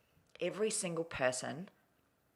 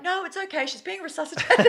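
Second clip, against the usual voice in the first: much higher, louder reassuring friend; breezy friend quote; 14 semitones higher, 10 dB louder than usual. Retold or imitated. Guest imitating her friend calmly reassuring everyone, high and loud. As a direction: chirp it like nothing's wrong. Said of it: "She's like".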